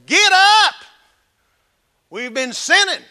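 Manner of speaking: high bellowed opening line; loud shout. Pretend. Preacher opening his Sunday sermon with a shout. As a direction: shock the congregation from the pulpit. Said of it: "just go".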